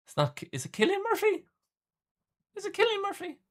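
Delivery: mock high falsetto question; teasing high squeak; squeaky high voice. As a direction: pitch way up like a cartoon. Asking name in squeaky voice.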